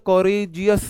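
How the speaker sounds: courageous slow and emphatic in two beats; two-beat pronunciation. Shown as a demonstration. Teacher asking students to try saying it, courageous in two slow beats. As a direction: stretch courageous, breaking before the final -geous. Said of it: "आप दो बार में pronounce करोगे".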